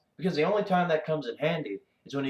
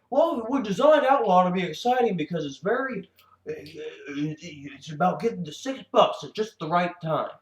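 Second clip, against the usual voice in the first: mock pretentious designer; 6 semitones higher than usual. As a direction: parody a self-satisfied game designer. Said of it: "how pretentious can you get with that".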